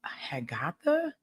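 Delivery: a breathy, baffled whisper of the new word; hushed disbelief at the word; puzzled whisper. Real, not acted. Puzzled repeat of odd word.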